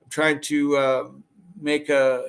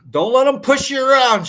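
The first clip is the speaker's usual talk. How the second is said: excited; 11 semitones higher, 7 dB louder than usual. Real, not acted. Cheering on a viewer.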